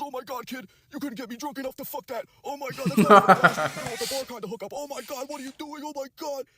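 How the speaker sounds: dad phoning his son to trash his date, squeaky mock rant; imitating his dad. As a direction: caricature the disgusted father, squeaky and exaggerated. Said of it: "He goes".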